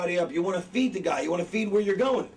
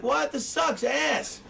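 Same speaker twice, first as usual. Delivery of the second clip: higher, drawn-out mock outrage; disgusted viewer; 7 semitones higher, much slower than usual. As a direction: react like an appalled moviegoer. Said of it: "You'd be like".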